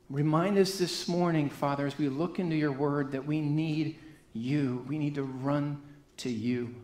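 soft, hushed opening prayer before the sermon; quiet prayer. Real, not acted. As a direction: pray quietly to the Father, reverent.

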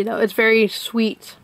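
ordinary talk

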